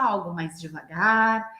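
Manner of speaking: slow, drawn-out coaching cues; slow, controlled. Shown as a demonstration. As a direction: stretch the key words with control. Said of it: "Mudando a entonação da minha voz". (devagar e controlado)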